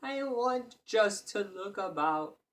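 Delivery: high, slow little voice; timid tailor voice; tailor whimpering. A narrator's reading. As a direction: shrink before the giant. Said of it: "the tailor whimpered".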